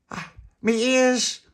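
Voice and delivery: high, loud, drawn-out moan; pained wail. Acted. Tony freed from the wall, pained wail. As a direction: wail over the sore ears. Tony moans about his ears.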